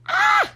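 very high, loud squeal; excited scream. Real, not acted. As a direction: let out a piercing short scream.

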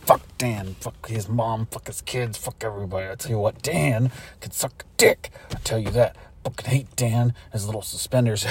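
low, bitter grumble; low aside full of spite; resentful muttering. Acted. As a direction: curse him under your breath. Private grumbling after a friendly greeting.

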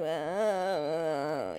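low, soft drawn-out moan; imitated moaning of the sick; mock sick groan. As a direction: groan like someone milking an illness. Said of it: "I'm not rolling around like".

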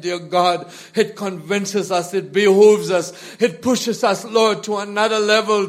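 ordinary talk

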